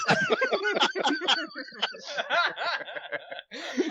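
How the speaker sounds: squealing with real laughter; losing it completely; high shrieking laugh. Real, not acted. Mic joke, hysterical laugh.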